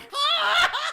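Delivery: high, loud wail; frustrated shriek. Real, not acted. Gamer reacting to a missed shot, frustrated shriek. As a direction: shriek in real exasperation.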